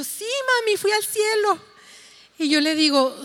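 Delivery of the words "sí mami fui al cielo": put-on high, earnest little girl; child's eager voice. Daughter insisting to her mother she went to heaven: high eager child voice.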